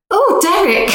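high, loud mock-alarmed cry; hammed-up high-pitched exclamation; Yvette. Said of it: "I remember Yvette going".

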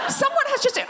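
very high, squeaky indignation; hammed-up protest at the top of your range; high-pitched outrage. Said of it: "I got a bit high-pitched".

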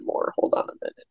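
soft whisper to herself; whispered hold on; whispered aside. Real, not acted. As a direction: mutter it off-mic. Aside while working on the edit.

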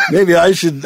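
loud, high laughing retake. Real, not acted. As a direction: laugh the words out. Bursts out laughing after pause.

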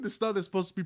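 normal talking voice

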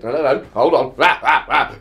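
loud blustering interruption; Farage impression. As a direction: bluster over everyone, loudly. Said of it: "very much like this".

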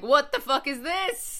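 loud, high, drawn-out disbelief; mock outrage. Teachers searching the sister's jacket, mock outrage.

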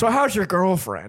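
mock actor drawl; Nicolas Cage impression. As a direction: imitate the bar guy, drawing it out. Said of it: "sounds like Nicolas Cage".

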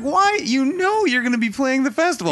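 very high-pitched, incredulous squeal; high, exasperated outburst at the band; exasperated mock plea. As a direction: plead loudly up in a squeaky high register. Voicing exasperated complaint at band.

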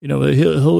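usual speaking voice

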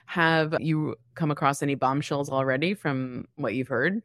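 ordinary talk

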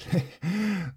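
high little nervous giggle; nervous titter. A narrator's reading. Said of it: "tittered nervously".

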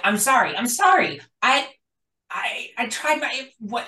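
high, rushed, apologetic stammer building to panic; injured soldier begging his general for forgiveness; pleading soldier. Acted. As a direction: babble sorries to an angry superior. GM voices injured Diesel pleading.

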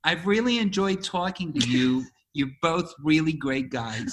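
careful put-on New York accent; attempted American accent. Shown as a demonstration. Co-guest copies the host's line, New York-ish American accent. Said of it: "You're, like, in New York".

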